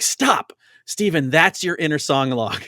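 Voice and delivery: imitated director's excited interruption; director calling a halt. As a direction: call out like a director halting a scene. Retelling a director interrupting a scene.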